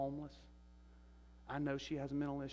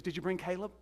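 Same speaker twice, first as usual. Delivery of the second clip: eager question; 8 semitones higher, 5 dB louder than usual. Retold or imitated. Mother asks about son.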